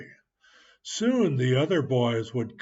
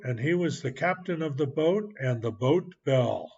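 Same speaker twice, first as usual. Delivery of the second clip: plain reading voice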